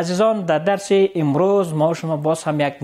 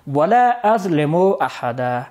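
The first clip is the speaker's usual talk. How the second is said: slow reading; slower than usual. Shown as a demonstration. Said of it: "همزهٔ قطع آمده".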